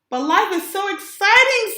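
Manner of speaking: high, loud gushing; excited. Acted. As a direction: burst out with joy. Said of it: "said Clark".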